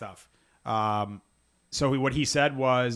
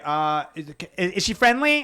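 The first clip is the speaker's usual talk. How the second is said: rising, higher and louder question; mock stranger; 6 semitones higher, 7 dB louder, slower than usual. Imitating a passerby asking if his dog is friendly, higher and louder. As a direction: ham up a nervous stranger's question.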